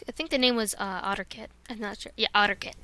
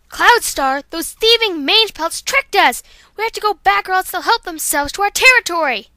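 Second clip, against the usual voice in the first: loud, higher, urgent outrage; furious warrior cat reporting a trick; Violet Mist outraged; 5 semitones higher, 9 dB louder than usual. Acted. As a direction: warn the leader of the thieves. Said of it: "here's Violet Mist".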